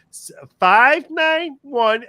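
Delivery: high, slow, sing-song phone-number voice; mother's put-on high telephone voice; mom's phone voice. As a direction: imitate mom reading her number on the phone. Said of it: "she'd be talking like this".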